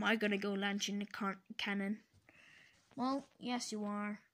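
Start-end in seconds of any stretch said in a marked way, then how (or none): none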